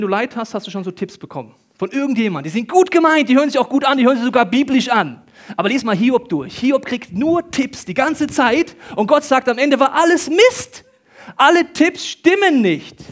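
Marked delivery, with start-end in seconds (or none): 1.8-13.0 loud, high, emphatic sermon delivery; full-force preaching. Real, not acted. Preacher exposing well-meant tips as false, full-force preaching. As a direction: hammer the point home at the top of your voice.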